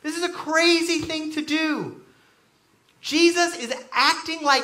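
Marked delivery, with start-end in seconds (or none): 0.0-2.0 high-pitched mock disbelief; incredulous challenge. Onlooker questioning a friend yelling at fires, mock incredulous. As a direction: challenge them, pitched well up.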